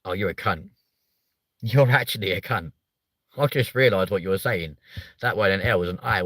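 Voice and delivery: normal talking voice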